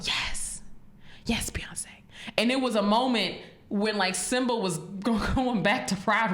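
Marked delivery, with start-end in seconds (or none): 0.0-1.8 hushed fan cheer, all breath; retold whisper. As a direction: whisper your praise during the movie. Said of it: "we're both whispering it".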